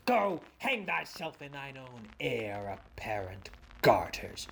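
unvoiced muttered curse; whispering. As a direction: whisper the insult at him. Falstaff curses under breath.

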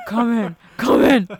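loud, booming demon voice; demonic voice. Retold or imitated. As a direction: boom it out like a demon. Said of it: "crazy demonic voice".

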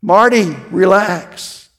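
slow, deliberate repeat. Retold or imitated. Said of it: "What kind of advice is that?".

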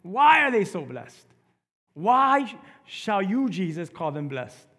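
loud and high, pressing the question. Acted. Preacher to congregation, why blessed, loud and high. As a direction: throw the question out hard.

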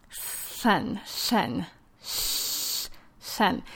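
"sun" turned into a wet, slushy "shen" with air out the sides; slushy sideways "shen", "sh-", "shen"; lateral lisp demo. Shown as a demonstration. Therapist demonstrating a lateral lisp for parents, slushy "shen". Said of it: "an example of a lateralized lisp instead of sun would be".

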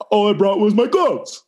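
high, smug would-be comedian; try-hard joker voice. Retold or imitated. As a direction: play the unfunny show-off. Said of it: "the person that is always trying to be so funny being like".

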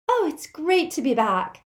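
high-pitched happy Luna. A narrator's reading. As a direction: say it with delight, voice lifted high. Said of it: "said Luna happily".

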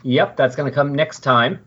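normal talking voice

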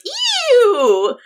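high, loud grossed-out ew; disgusted ew. Acted. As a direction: recoil with a shrill yuck. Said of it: "suddenly coils back and goes".

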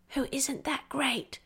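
cheeky unvoiced child whisper; naughty whisper. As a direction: whisper it like a little secret. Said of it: "she added in a naughty whisper".

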